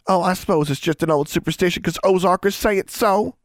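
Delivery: high, indignant sarcastic retort. A narrator's reading. Young Ozarker glowering at her doubter, sarcastic. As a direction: throw it back huffily, pitched well up.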